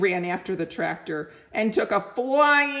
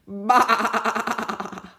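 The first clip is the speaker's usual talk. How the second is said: sheep bleat; 3 semitones higher than usual. Acted. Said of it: "said Sheep".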